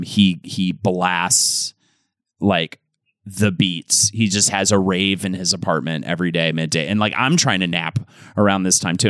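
ordinary talk, nothing marked

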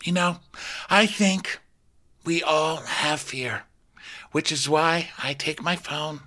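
high, slow, halting pronouncement; mock solemn Rinna. Rinna confessing that all have fear, mock-solemn. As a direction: draw it out with grand pauses. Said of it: "And she goes".

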